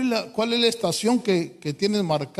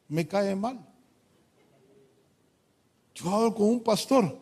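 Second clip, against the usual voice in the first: normal talking voice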